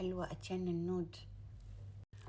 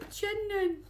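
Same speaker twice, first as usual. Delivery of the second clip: excited squeal; 13 semitones higher, 4 dB louder, slower than usual. Real, not acted. Gushing over shades.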